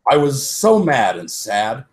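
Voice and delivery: plain reading voice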